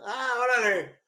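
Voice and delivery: exclamación aguda y fuerte de sorpresa; sorpresa actuada. Retold or imitated. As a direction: reacciona como quien cae en cuenta, bien alto. Reacciona al saber que es fiesta. (sorprendido, agudo y exagerado)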